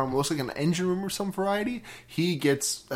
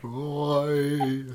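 very slow, drawn-out mock British accent; John Hurt impression; 5 dB quieter, much slower than usual. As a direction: pretend the ghost of John Hurt is speaking through you. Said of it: "The ghost of John Hurt possessing your body".

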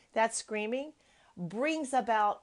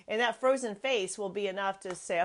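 ordinary talk

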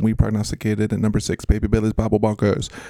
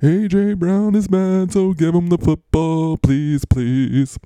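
higher, louder put-on voice begging; mock pleading; 7 semitones higher, 4 dB louder, slower than usual. Host voices an angry receiver pleading for the ball, mock whiny. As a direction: whine for the ball in a silly voice. Voicing AJ Brown's complaint.

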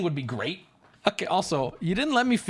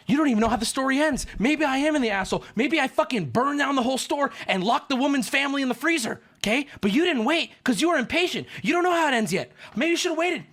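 higher-pitched, worked-up rant; heated scolding; 5 semitones higher than usual. Real, not acted. Storyteller scolding impatient listeners, heated and indignant. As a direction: scold them in a raised pitch.

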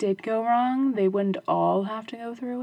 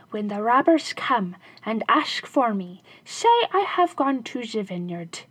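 louder, urgent shoemaker voice; scheming shoemaker; 4 dB louder than usual. Acted. Shoemaker briefing his wife before the robbers come, sly. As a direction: give quick sly instructions.

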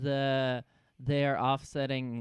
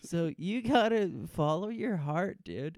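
hammed-up heartfelt advice; 7 semitones higher than usual. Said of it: "mock Saeed advice".

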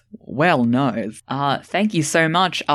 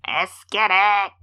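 hype catchphrase; 5 semitones higher than usual. Retold or imitated. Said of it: "the Miles soundboard".

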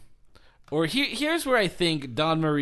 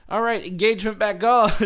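breezy mock; 7 semitones higher, 5 dB louder than usual. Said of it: "She's like".

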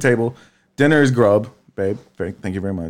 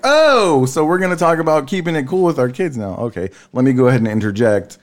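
retold indignant comeback, pitched up; mock realization; 4 semitones higher than usual. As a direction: pounce on it with feigned surprise. Retells own indignant comeback.

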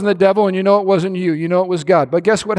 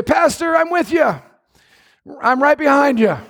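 loud eager promise; 6 semitones higher than usual. Retold or imitated. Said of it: "They say it with a whisper".

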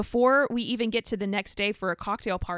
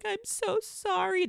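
much higher, slow whiny fake sob; mock crying; 10 semitones higher, 3 dB quieter, slower than usual. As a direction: mimic a teary influencer's apology, drawn out. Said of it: "crying, being like".

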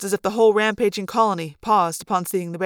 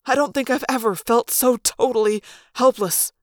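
plain reading voice

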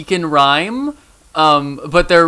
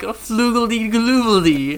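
higher, slow silly nonsense voice; teasing imitation; 7 semitones higher, much slower than usual. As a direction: mimic a goofy made-up word. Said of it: "just being like".

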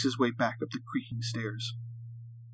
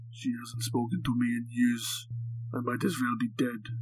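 put-on captive with a heavy Russian accent; thick Russian accent; 5 dB quieter than usual. A narrator's reading. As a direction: speak English like a Russian prisoner. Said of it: "his thick Russian accent coming through".

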